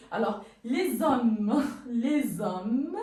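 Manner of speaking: les hommes said as lé-zommes; Z liaison. Shown as a demonstration. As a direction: link les with a Z sound. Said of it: "on entend ze".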